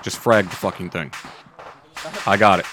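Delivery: ordinary talk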